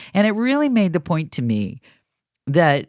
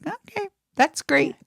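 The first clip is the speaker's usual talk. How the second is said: reply high and thin, feigning approval; feigned approval; 12 semitones higher than usual. Retold or imitated. Host recalling her reply to a boastful party guest, feigned approval. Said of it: "And I'm like".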